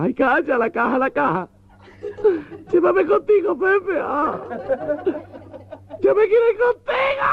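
very high, wailing camp sob; dramatic crying widow. Acted. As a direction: overact the grief of a heartbroken lover. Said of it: "con la vena dramática llorando".

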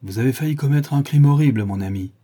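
stern rebuke to the would-be killer. A narrator's reading. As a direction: scold him sternly. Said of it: "le regardant sévèrement".

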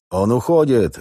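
loud, high shouted alarm; barking shout. A narrator's reading. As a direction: bellow it at full voice. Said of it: "гаркнул капитан во весь голос".

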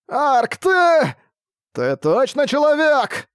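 high, loud furious squeal; shrieking. Acted. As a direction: scream at him in outrage. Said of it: "Истошный визг Буксила". (истошный визг)

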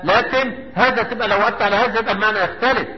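normal talking voice

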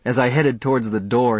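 plain reading voice

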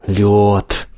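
strained hiss through teeth. Acted. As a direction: hiss it out in pain. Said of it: "прошипел он сквозь зубы".

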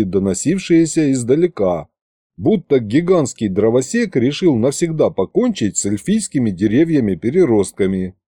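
plain reading voice

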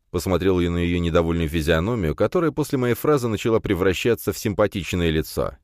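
plain reading voice

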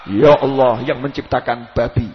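low, slow mock supplication; mock prayer. Shown as a demonstration. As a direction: intone an improper prayer with exaggerated solemnity. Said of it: "Coba jenengan duduk di masjid doa".

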